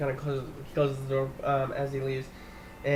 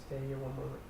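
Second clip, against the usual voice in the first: low, much softer hushed aside; hushed Gloria; 4 semitones lower, 11 dB quieter, faster than usual. Acted. Gloria telling the guests to stay put, hushed. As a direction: keep it low so they won't hear. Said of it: "Gloria was like".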